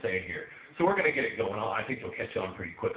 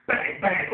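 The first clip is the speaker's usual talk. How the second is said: high, loud double bang; shouted joke-gun shots; mock gunfire; 10 semitones higher, 10 dB louder than usual. Comic firing joke guns after his punchline, shouted mock gunfire. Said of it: "Oh, they're overheated".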